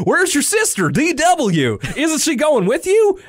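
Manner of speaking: high-pitched cartoon southern accent; imitated southern man, urgent and high. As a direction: call it out in a put-on southern accent. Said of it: "kind of southern accent".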